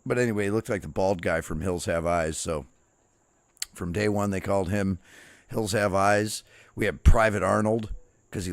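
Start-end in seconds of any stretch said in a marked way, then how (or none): none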